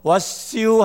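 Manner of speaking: high, questioning Chungcheong-dialect greeting. Retold or imitated. Barber to the American customer, in Chungcheong dialect. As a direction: Chungcheong lilt pitched well up. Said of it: "근데 이 미국 사람이 딱 들어보니까".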